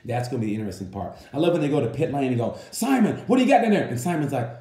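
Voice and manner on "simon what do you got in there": called out high and loud over pit noise; calling out. Retold or imitated. Reporter in pit lane calling out a question to Simon, loud and high. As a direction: shout the question across the pit.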